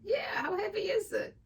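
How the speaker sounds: hammed-up high yell. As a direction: belt it out like a cocky guy. Quoting him, loud and high.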